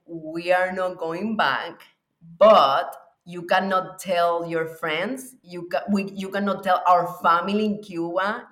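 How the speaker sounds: ordinary delivery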